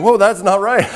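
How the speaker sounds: high, amused take on a doubtful viewer; a laughing, high whoa; mock surprise. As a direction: play the viewer catching the mistake, laughing. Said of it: "I know you were going".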